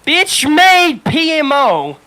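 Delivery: slow, loud, dragged out sneer on bitch-made P M O; drawn-out insult. Acted. Venting to friends about his supervisor, slow sneering drawl. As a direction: stretch every syllable with contempt.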